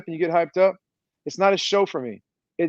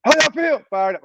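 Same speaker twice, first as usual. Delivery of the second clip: hype call and answer; 9 semitones higher than usual. Shown as a demonstration. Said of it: "I need to hear your voice".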